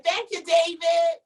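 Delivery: thanking a viewer slowly and happily; drawn-out thanks. Real, not acted. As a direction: stretch out the thanks warmly.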